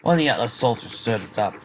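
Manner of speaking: ordinary delivery, nothing marked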